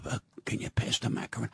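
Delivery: very low, hushed, quick request; soft-spoken wife. Retold or imitated. Wife asking husband at dinner, timid and hushed. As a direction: imitate a meek wife, barely audible. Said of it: "she goes".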